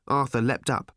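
ordinary delivery, nothing marked

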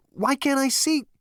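very high-pitched, agitated demand; alarmed question. A narrator's reading. Zaphod questioning the unseen voice, alarmed. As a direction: shoot it up in disbelief.

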